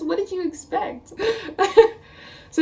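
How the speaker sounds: ordinary delivery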